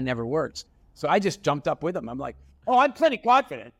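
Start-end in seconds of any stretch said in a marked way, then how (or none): 2.7-3.7 much higher, louder defiant retort; loud, high-pitched comeback; retold shout. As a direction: fire it back to match his energy. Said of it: "I just shouted at Tony".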